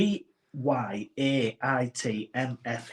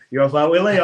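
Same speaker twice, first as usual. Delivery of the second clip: teasing coworker; 10 dB louder than usual. Retold or imitated. Coworkers teasing, voiced loudly.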